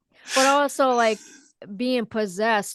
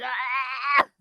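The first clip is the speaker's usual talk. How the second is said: mock choking gasp; 3 semitones higher than usual. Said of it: "choking on".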